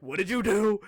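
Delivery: mock mom yelling an accusation; high, loud demand, hammed up; mom's alarmed demand. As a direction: imitate a mother yelling at her kid. Mom confronts him in retold story.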